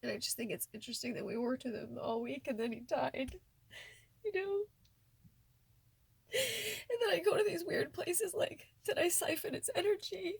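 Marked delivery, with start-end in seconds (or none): 3.8-10.3 high, teary voice with a laugh in it; half laughing, half crying; real tears breaking through a laugh. Real, not acted. Laughing through tears about guilt.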